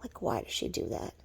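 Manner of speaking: low, soft, nearly whispered question; hushed bafflement. Real, not acted. Asking why she does that, hushed bafflement. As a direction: murmur it under your breath, baffled. Asks in a hush, then repeats.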